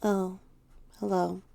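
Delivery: different voice. A narrator's reading. Teddy greets you coming home, in a subdued different voice. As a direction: greet with little enthusiasm, quietly. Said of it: "says Teddy in a different voice".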